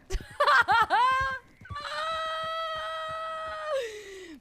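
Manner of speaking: high, loud burst of laughter; loud laughing fit. Real, not acted. Player cracks up trying to voice a backstory, high laughing fit. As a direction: crack up into a long squealing laugh. Cracks up at own accent attempts.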